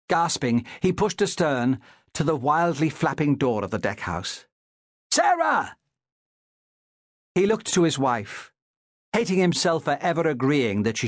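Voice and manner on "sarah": high, falling cry of the name; high-pitched alarmed call; urgent shout. A narrator's reading. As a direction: shout for your wife over the storm. Master calls wife during shipwreck.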